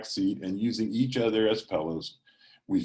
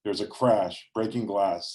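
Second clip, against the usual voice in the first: plain reading voice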